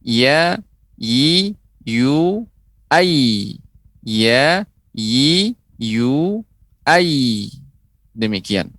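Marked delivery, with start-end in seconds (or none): 0.0-7.5 YA, YI, YU, AI one at a time, rising; letter ya demo. Shown as a demonstration. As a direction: sound out ya, yi, yu, ai as drawn-out syllables. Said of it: "huruf yang ketiga adalah huruf ya". (contoh huruf ya: ya, yi, yu, ai)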